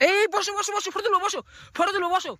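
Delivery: high sing-song urging to sit. Real, not acted. Coaxing child to sit for photo. (উঁচু আদুরে গলায় বসতে বলা)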